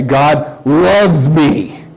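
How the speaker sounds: "loves" drawn out long as the key word; very slow, stretching "loves" for emphasis; stress on LOVES. Shown as a demonstration. Preacher showing the congregation how to emphasize different words, stress on "loves". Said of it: "emphasize the different words".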